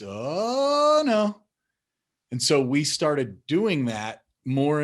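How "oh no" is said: high, louder drawn-out oh no; mock dismay. Father imitating his son's playful long oh no. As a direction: stretch it like a game. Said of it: "one day he says".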